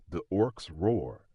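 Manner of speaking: plain reading voice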